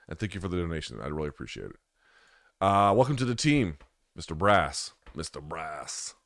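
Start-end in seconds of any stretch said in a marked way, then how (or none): 5.1-6.1 drawn-out, hushed echo of the name; savouring the name quietly; soft slow repeat. Real, not acted. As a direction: say "Mister Brass" again slowly, almost under your breath. Repeats member name to himself.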